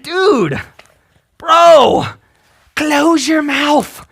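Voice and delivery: ordinary talk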